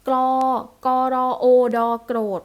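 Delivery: loud teacher's spelling drill, ending โกรธ with a ด sound. Shown as a demonstration. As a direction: sound out กรอ, โอ, ดอ, then blend into โกรธ. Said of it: "เพราะฉะนั้น ทอทงเนี่ย ออกเสี". (สะกดคำว่า โกรธ เสียงดังทีละเสียง ตัวท้ายออกเสียง ดอ)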